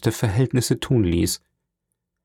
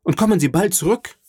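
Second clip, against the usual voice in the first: urgent call; 9 semitones higher, faster than usual. A narrator's reading. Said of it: "riefen alle Stimmen".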